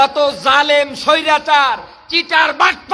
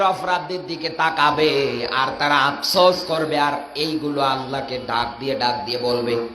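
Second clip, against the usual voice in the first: much lower, softer mournful preaching; sorrowful lament; 11 semitones lower, 5 dB quieter than usual. Real, not acted. Preacher on believers regretting their sins, sorrowful lament. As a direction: sink into a low, hushed lament.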